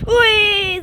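high triumphant shout; excited yell. Real, not acted. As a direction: yell with joy. Jumping game, success cry.

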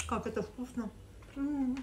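chewing while talking; speaking between bites; mouth full of food. Real, not acted. Seen 0: Eating pasta throughout; mouth closed, chewing while speaking between bites.

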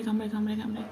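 ordinary talk, nothing marked

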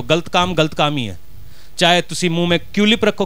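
ordinary talk

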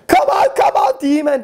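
very high, loud command at the demon; loud commanding shout. Retold or imitated. The men shout the demon out even harder. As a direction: roar it out, raising the pressure. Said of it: "became loud and command even more".

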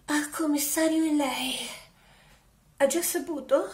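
normal talking voice

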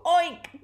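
high, loud oink; pig oink. A narrator's reading. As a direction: squeal an oink loudly. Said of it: "let out a deafening sound".

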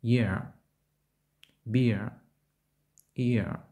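"Year", "Beer", "Ear" with I gliding into neutral E or A; дифтонг іе. Shown as a demonstration. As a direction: start on I and relax the end of "Year", "Beer", "Ear". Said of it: "Вимова починається з голосного І". ("Year", "Beer", "Ear" з переходом від І до нейтрального Е)

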